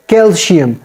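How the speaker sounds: "calcium" with a sh sound for the c; "calcium" said like "calshium"; mispronounced calcium. Shown as a demonstration. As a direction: say "calcium" as kel-shee-um. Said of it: "it is not calcium".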